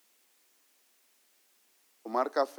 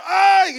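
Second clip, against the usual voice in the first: loud, high "ay" mimicking a yell; retold pained yell; 12 dB louder than usual. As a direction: yelp out an imitated cry of strain. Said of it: "Y ya unos gritotes".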